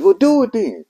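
high, strained imitation of pleading; retold pained urging. Friend answering a man on the bridge, imitated high and pained. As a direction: mimic a friend pushing through distress. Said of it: "I could tell he was crying".

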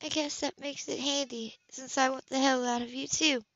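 lightly husky heroine; throaty, dark. Acted. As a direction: let it drop husky and dark. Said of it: "Her voice was throaty now".

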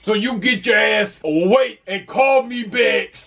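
shouting, furious voicemail rant; angry rant. Acted. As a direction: yell at her like a fed-up parent.

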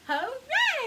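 high, loud hooray; joyful shouted hooray. Acted. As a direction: cheer it out for the kids. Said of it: "shout hooray".

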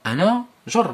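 أنا جر said high and loud as a deliberate mistake; mock the impossible form أنا جر; teacher showing that أنا جر is wrong. Shown as a demonstration. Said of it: "ما يمكنش".

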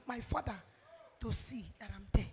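usual speaking voice

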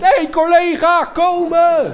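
loud high eager call; calling a colleague. Retold or imitated. Boy calls the comedian to ride along, loud high call. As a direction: shout it out like greeting a buddy. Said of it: "dan denkt".

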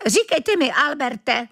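put-on high-pitched, choked old-bird croak; strangled croak. A narrator's reading. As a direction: squeeze the words out high and croaky. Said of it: "zaskřehotal pán přiškrceným hlasem". (přiškrcené vysoké skřehotání)

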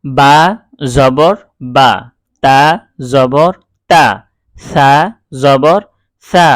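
loud letter-by-letter spelling, each vowel kept short; teacher's spelling chant; short fatha drill. Shown as a demonstration. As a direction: call out each syllable crisp and short. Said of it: "কোনো টান হবে না".

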